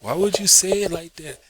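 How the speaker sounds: high, incredulous mock question; imitated confused stranger; puzzled onlooker. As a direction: act baffled by the odd remark. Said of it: "They'd be like".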